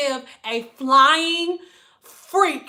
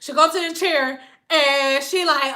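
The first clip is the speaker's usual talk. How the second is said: ordinary talk, nothing marked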